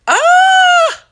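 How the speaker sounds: very high, very loud squeal of delight; joyful scream. Real, not acted. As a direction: let out a thrilled shriek. Overjoyed outburst.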